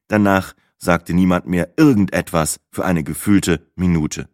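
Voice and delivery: ordinary delivery, nothing marked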